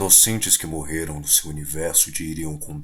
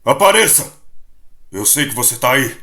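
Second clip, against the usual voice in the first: loud, high battle call into the dark; shouted challenge, then a little less loud; challenging shout; 9 semitones higher, 10 dB louder than usual. Acted. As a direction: call out the hidden enemy, demanding. Shadow calls out to hidden foe.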